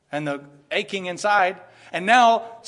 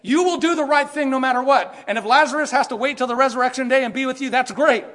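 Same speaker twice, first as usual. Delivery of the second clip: louder, fast, emphatic quote; hammed-up loud quote of Jesus; imagined voice of Jesus; 5 semitones higher, 6 dB louder than usual. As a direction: preach the imagined answer with force. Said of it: "instead of saying".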